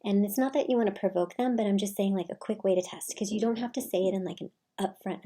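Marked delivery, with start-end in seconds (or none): none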